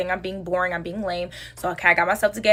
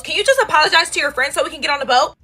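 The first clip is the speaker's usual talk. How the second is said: high, louder imitation of a girl; random girl pleading; 7 semitones higher, 5 dB louder, faster than usual. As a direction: act out a bystander's impatient request. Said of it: "some random girl comes around".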